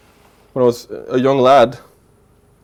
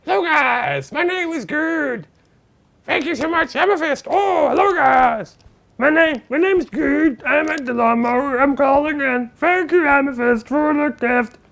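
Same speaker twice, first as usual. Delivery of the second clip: much higher put-on caller voice; silly high-pitched phone-in voice; Gerd voice; 14 semitones higher than usual. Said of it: "Gerd just called in".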